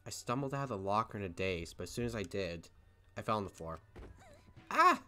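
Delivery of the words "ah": pained cry. Acted. Reads fall in female voice.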